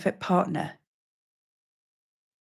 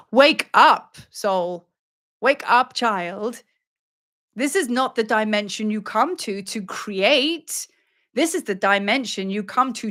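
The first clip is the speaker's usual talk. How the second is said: commanding goddess; 5 semitones higher, slower than usual. Acted. Goddess tells a soul to wake up, commanding. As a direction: rouse them, then intone with weight.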